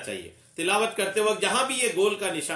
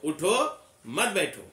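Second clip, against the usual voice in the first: उठो on its own, a beat, मत बैठो falling; pause after उठो. Shown as a demonstration. Teacher showing the intended meaning, pause after उठो. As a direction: stop cleanly after "उठो", then "मत बैठो". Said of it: "के बाद आप रुके थोड़ा".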